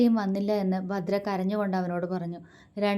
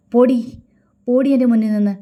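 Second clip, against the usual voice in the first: much louder furious dismissal; angry; 4 semitones higher, 11 dB louder than usual. A narrator's reading. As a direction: drive her out angrily. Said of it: "അവൻ ദേഷ്യത്തോടെ അവളോട് പറഞ്ഞു".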